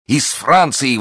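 high, excited echo; exclamation. A narrator's reading. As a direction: exclaim at unexpected news. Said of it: "воскликнул герцог".

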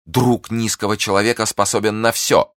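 high, forceful accusation; loud declaration. Acted. Prisoner accusing her enemy to the guard, loud and high. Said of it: "она продолжала громко".

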